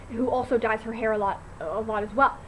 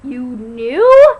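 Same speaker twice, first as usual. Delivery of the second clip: much louder, indignant disbelief; shocked outburst; 16 dB louder than usual. Retold or imitated. To best friend Stephanie who hid it, loud shocked outburst. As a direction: blurt it out loud, betrayed.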